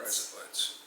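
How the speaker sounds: soft attempt at an Australian accent; tentative Australian "razor blades". Shown as a demonstration. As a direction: echo "rise up lights" quietly, as if Australian. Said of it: "razor blades in Australian accent".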